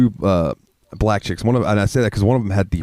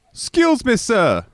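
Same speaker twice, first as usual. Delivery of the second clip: high-pitched imitation of a Jamaican woman; Jamaican accent; slower than usual. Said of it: "I love the Jamaican accent".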